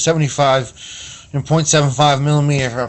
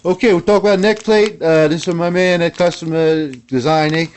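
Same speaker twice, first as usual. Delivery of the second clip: raised, loud hoser show-host voice; Canadian hoser host; 7 semitones higher, 6 dB louder than usual. Acted. Opening the Canadian Corner segment for viewers, hoser host. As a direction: play up the Canadian "eh" host, pitched high.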